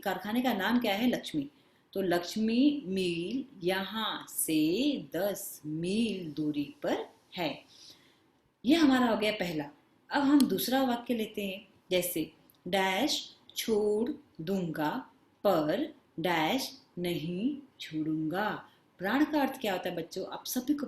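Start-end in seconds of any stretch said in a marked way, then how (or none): 2.0-7.5 very slow, word-by-word example sentence; exercise read-out. Shown as a demonstration. Teacher gives children the completed sentence, slow and deliberate. 12.7-18.5 slow, spaced-out dictation style; exercise read-out. Shown as a demonstration. Teacher reads second sentence to children, slow and deliberate.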